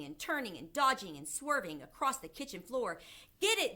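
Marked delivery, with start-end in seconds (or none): 3.4-3.8 high, loud urgent order; shouting. A narrator's reading. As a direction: shout like a mob giving chase. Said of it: "they were shouting".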